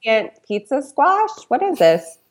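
baffled by a recipe title; mock confusion. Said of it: "I'm like".

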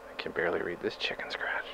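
muttered under the breath; whispering. Acted. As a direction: whisper while squinting. Squinting at the note.

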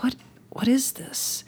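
whispered, slow and high, in disbelief; bewildered whisper. Retold or imitated. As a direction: breathe the question as if in a daze. Dazed rider seeing strange colors.

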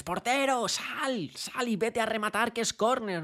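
high, loud call to the goalkeeper; urging shout. Retold or imitated. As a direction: get the keeper up for the corner. Al portero le dices. (grito agudo y fuerte al portero)

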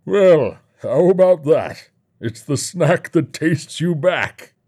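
high, loud showman with drawn-out words; taunting showman. Acted. Showman to the fat men, taunting grandly. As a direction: play a booming host enjoying his own line.

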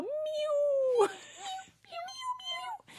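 high mew mew mew; kitten mews. Pretend. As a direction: meow like a kitten. Kitten noises.

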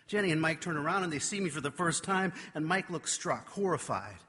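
ordinary talk

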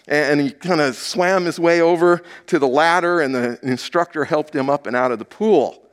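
usual speaking voice

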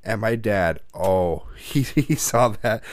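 usual speaking voice

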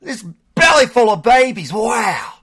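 high, loud hammed-up Australian croc-wrangler voice; Crocodile Hunter impression. Host teasing cohost by imitating the Australian Crocodile Hunter, high and loud. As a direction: ham up an excited Australian wildlife host. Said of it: "The Crocodile Hunter".

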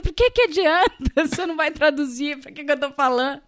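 high, exasperated mock scolding, half laughing; retold fury. Guest berating Roberto for not translating, retold fury. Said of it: "Aí eu fiquei com ódio, comecei a xingar o Roberto".

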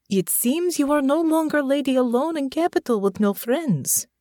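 put-on teasing dry remark in a Russian accent; Russian-accented woman. A narrator's reading. Natasha teasing Honor about her new friend, wry Russian accent.

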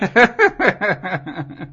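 high-pitched hyena cackle; cackling laugh. Real, not acted. Host cracks up at guest's snappy comeback, cackling. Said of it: "it's a cackle".

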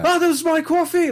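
high squeaky mock-outraged cry; hammed-up outraged shop owner; mock outrage. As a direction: play the furious coffee-shop owner, hammed up. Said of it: "he was so, like, outraged".

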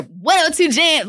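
high, punched-up radio announcer; put-on announcer voice. Radio host opening her show on air, put-on announcer voice. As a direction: hit it like you are changing your voice for radio. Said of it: "trying to change my voice".

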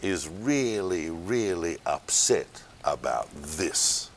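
teacher dropping to a slow hush before the reveal; hushed suspense. Acted. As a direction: lean in and hush to build suspense. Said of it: "teller builds suspense".

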